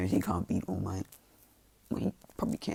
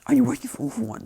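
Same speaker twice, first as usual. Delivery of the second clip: acted accusation; 7 dB louder, faster than usual. All Might confronts Deku in anger.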